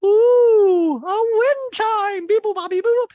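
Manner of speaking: high loud slow caricature of a gushing rich woman; Dorit voice. Retold or imitated. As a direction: gush it in a high, loud mock-posh voice. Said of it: "And Dorit goes".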